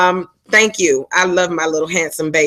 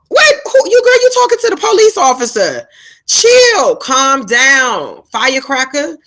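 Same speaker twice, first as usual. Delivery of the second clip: much higher, louder exclaiming; incredulous retort; 7 semitones higher, 4 dB louder, slower than usual. Retold or imitated. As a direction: shoot it back high and loud in disbelief. Retelling her own retort at neighbor.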